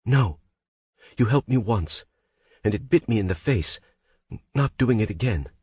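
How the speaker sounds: high, tearful refusal; upset refusal. Acted. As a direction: push him away through tears. Heroine refusing his help, upset.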